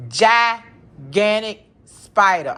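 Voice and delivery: GIGANTIC and SPIDER each loud and drawn out; shocked emphasis. Retold or imitated. Woman finding a spider by her shoe, shocked emphasis on Gigantic Spider.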